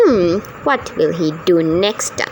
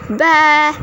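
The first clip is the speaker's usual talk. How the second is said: bleating like a sheep; playful sheep noise; 5 semitones higher than usual. Acted. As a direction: baa it out like a sheep. Sheep voice in story.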